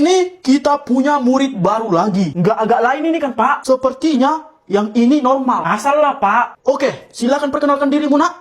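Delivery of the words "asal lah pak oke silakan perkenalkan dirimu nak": ordinary delivery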